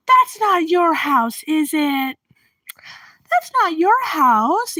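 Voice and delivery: put-on slow, high old-lady voice; disbelieving elderly neighbor. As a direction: stretch it out in oblivious doubt. Acts oblivious neighbor's disbelieving reply.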